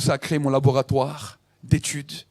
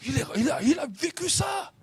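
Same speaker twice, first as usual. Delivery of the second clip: rising, much higher cry of wonder; amazed exclamation; 9 semitones higher, 5 dB quieter than usual. Retold or imitated. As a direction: awestruck high shout. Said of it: "Je dis".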